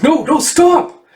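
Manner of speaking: high, loud cry to stop; doctor shouting to stop the attack; panicked shout. Acted. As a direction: yell in alarm to stop them. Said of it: "And Finch is like".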